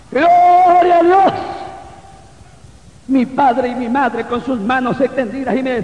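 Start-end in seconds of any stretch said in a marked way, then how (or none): 0.1-1.3 drawn-out, high, loud praise cry; grito de alabanza. Real, not acted. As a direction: shout praise, stretching the first word. (grito de alabanza agudo y alargado)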